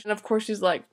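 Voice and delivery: ordinary talk, nothing marked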